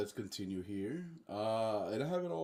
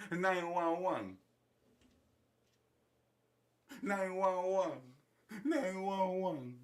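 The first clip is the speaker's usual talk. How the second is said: mock panic; 5 semitones higher than usual. Acted Maduro calling for an ambulance.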